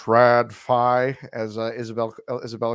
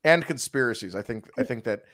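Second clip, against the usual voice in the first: ordinary delivery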